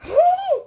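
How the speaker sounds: sharp high gasp; dramatic gasp; shocked gasp. Acted. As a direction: suck in air in shock. Shock at the cat kicked.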